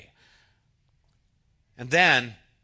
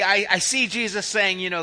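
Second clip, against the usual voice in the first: normal talking voice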